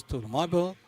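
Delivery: usual speaking voice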